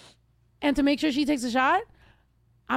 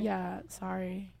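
soft, offhand sorry; insincere apology; 6 semitones lower, 12 dB quieter, much slower than usual. Real, not acted. Host apologizes to co-host, not sounding sorry. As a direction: toss off an apology without meaning it. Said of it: "didn't really sound sorry about that".

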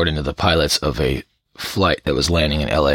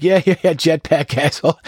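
higher, louder, laughing brush-off; mock dismissive controller; 10 semitones higher, 4 dB louder than usual. Worn-out controller mocking the pilot, laughing and higher. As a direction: wave it off with a laugh. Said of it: "that he's like".

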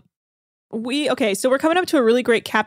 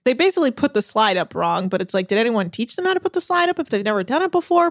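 ordinary delivery

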